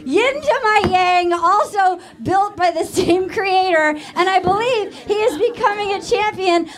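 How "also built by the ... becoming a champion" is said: normal talking voice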